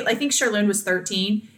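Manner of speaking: ordinary talk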